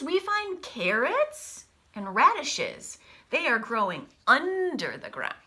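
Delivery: lively storybook read-aloud voice; picture-book reading with big pauses; reading to children. Acted. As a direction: read it brightly to a class of kids. Teacher reads picture book aloud.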